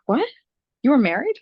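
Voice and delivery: loud, high-pitched disbelief; surprised. Retold or imitated. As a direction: retell it as an astonished, incredulous question. Retold shock at divorce news.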